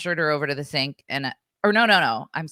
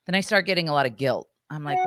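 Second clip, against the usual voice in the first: ordinary talk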